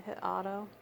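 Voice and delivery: ordinary talk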